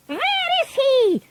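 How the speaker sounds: high, sharp witch snap; snapping witch. A narrator's reading. Grand High Witch searching for the mouse, snapping. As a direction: snap the question irritably. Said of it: "snapped the Grand High Witch".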